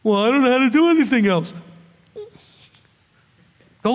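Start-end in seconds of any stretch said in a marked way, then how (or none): 0.0-1.5 whiny excuse voice. Retold or imitated. Mock excuse from broke man.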